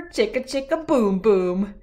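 usual speaking voice

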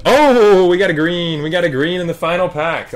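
thrilled whoop at a surprise hit; excited. Real, not acted. Card breaker spots a green in the final pack, excited. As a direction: burst out high and loud, then keep it high.

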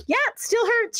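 high, strained-sounding mock protest; retold pained complaint. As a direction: imitate a patient confirming it hurts. Sister in pain answers the resident.

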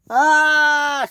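high, loud scream; scream of fright. Acted. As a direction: scream as you fall. Scruff screams.

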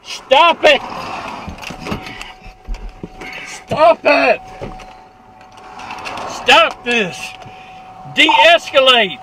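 loud, desperate shouted plea; shouting. Real, not acted. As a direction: yell for him to stop. Driver resists officer.

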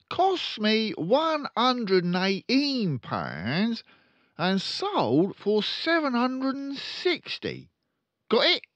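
loud, high, very slow dictation; slow dictating father. Acted. Father dictating sums to his son, slow and loud. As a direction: spell out each word for the boy.

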